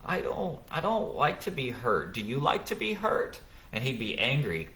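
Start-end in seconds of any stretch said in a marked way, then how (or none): 0.1-3.4 high, soft, wounded ow, ow; acting hurt. Father fakes being hurt to his angry son, high and soft. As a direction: play the hurt parent so the child notices. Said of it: "I would act hurt".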